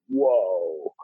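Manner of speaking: long, falling "whoa" of amazement; awed whoa. Retold or imitated. Recalling the vision to listeners, awestruck. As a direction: let it stretch out in wonder. Acting out his awe at the vision.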